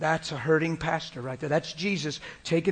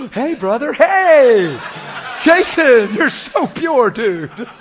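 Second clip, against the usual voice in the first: much higher and louder, laughing; loud mock greeting; 10 semitones higher, 14 dB louder, much slower than usual. Teasing a raised friend, loud and laughing. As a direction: shout it like a buddy back from the dead.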